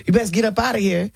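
lightly voiced Southern man telling her to leave; a hint of a stern old Georgia man; mild put-on warning from a stranger at the window; voicing the stranger. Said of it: "make the guy sound like a slave".

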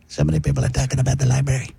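ordinary talk

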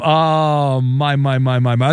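usual speaking voice

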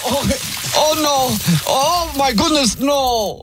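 high, hammed-up alarmed cries in a foreign accent; put-on accent, faked panic; mock panic. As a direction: fake a roof collapse, panicked. Prankster fakes panic on roof.

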